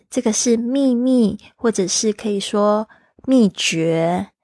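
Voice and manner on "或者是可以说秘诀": normal talking voice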